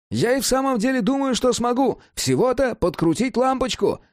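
quick, high-pitched eager voice; excited Cat. A narrator's reading. Cat tells Jack about fixing the bulb, excited. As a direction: chatter away eagerly, pitched far above narration.

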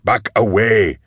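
firm command, held back from a full yell; shouted order. A narrator's reading. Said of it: "Goblika ordered".

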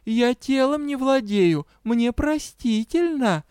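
high, slow kitten self-defence. A narrator's reading. Kitten excusing her accident, high-pitched. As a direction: excuse yourself as a little kitten. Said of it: "ответила кошечка".

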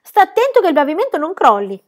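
loud warning shout. A narrator's reading. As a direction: yell the warning. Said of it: "Pippi gridò".

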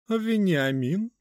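high, slow, mannered lady calling; drawn-out affected diva call. A narrator's reading. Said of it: "раздался высокий манерный голос". (высокий манерный голос)

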